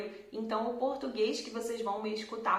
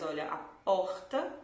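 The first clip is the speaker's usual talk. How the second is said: ordinary talk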